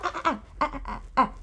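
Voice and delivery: soft, tutting string of ah-ahs; scolding "ah-ah-ah". Pretend. Brother stopping his sister mid-insult, mock scolding. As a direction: cut someone off with little warning ahs.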